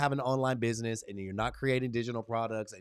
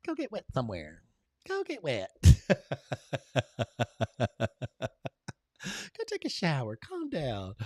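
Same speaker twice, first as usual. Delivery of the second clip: mock polite boss; 7 semitones higher than usual. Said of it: "politely be like".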